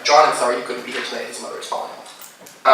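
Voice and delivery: ordinary delivery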